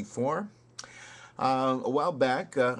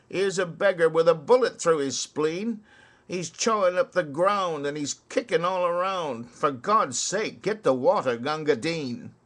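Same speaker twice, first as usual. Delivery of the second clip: higher, louder desperate call; urgent plea; 6 semitones higher, 6 dB louder than usual. Acted. Wounded soldier pleading for water, urgent louder cry. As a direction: beg for water for a dying man.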